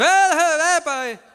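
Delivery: high, loud, laughing yelled syllables; hammed-up shouting churchgoer; mock shouting brother. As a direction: imitate a brother who talks by shouting. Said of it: "Hablan gritado".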